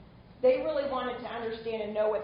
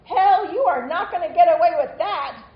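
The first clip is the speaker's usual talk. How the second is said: loud, raised defiant retort; 6 semitones higher, 12 dB louder than usual. Acted. Reading out an activist's reply to a politician, defiant. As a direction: punch it out, higher and much louder.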